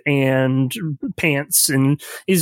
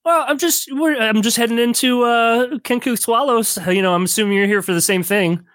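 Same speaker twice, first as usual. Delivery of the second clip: higher-pitched, chatty newcomer voice; hesitant, pitched-up character chatter; scrawny stranger; 5 semitones higher than usual. Acted. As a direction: make awkward small talk as the stranger. Game master plays the scrawny stranger.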